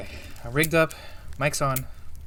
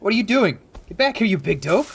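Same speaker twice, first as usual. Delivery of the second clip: loud, higher scolding yell; shouting; 6 semitones higher, 6 dB louder than usual. Acted. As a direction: call him back in a scolding yell. Yelling at a reckless friend.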